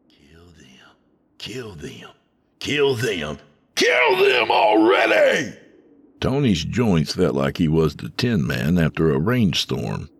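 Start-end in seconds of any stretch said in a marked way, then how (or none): 0.1-5.5 whisper building to a scream. A narrator's reading. Inner voice grows from whisper into a deafening scream. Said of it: "grew in volume until it was a deafening scream".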